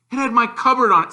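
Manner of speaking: Harry fires back, angry and quick; angry boy. A narrator's reading. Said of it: "said Harry angrily".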